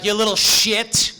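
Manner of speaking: loud, slow, high furious shout; angry dad shout. Retold or imitated. Father shouting at his son across the meeting, furious. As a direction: bellow it at your kid from across the room. Dad yelling back at kid.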